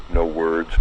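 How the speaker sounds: plain reading voice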